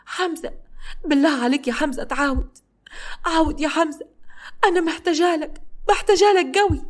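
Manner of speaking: high tearful pleading; crying, afraid. A narrator's reading. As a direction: beg him through tears to come back. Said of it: "وعيطت بصوت مسموع وقالت له بخوف". (بكاء وتوسل بصوت عالي)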